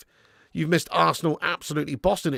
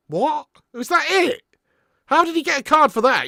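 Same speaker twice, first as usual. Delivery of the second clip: incredulous disbelief; 11 semitones higher, 5 dB louder than usual. Real, not acted. Reaction to the card decision.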